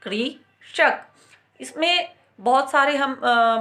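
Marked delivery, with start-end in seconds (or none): 0.0-1.1 कृषक said very slowly as kri-shak; slow ri sound. Shown as a demonstration. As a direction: draw out कृषक syllable by syllable. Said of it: "और हम बोलते हैं".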